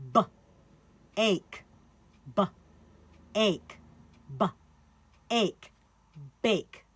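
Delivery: B, then ache, said apart, then blended into bake; segmenting onset and rime. Shown as a demonstration. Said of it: "So for bake, that's".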